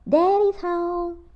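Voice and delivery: high, loud, slow delighted squeal; a little girl thrilled to hear the garage door; squealing with joy. A narrator's reading. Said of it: "squealed Karen".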